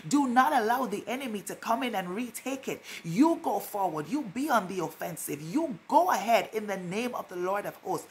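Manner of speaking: ordinary talk, nothing marked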